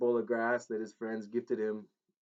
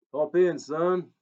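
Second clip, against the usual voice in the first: cried out; 6 semitones higher, 10 dB louder, slower than usual. Acted. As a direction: call him in like the King himself. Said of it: "the king cried".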